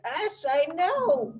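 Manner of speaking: high, slow mock refusal; Spy refusing Medic in a high voice; angry Spy. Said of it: "Spy's angry at Medic".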